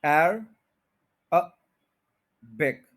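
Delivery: एर, अ, बिक with pauses between; syllable by syllable. Shown as a demonstration. Teacher counting three syllables, each said separately. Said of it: "three syllables हैं".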